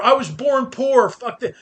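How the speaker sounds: hammed-up grumble about being born poor; mock bitter rant. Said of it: "don't shake your fist at the sky going".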